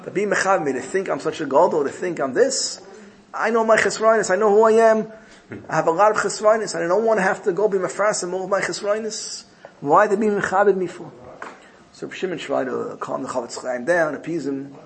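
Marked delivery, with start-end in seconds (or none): 0.0-11.1 high, insistent imitation of a distressed rabbi; retold plea of unworthiness, pitched high; retold upset protest. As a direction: act out anguished self-reproach, building louder, no sobs. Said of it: "Chofetz Chaim said, "I'm crying".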